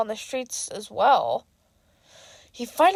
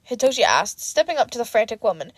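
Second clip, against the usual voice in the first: plain reading voice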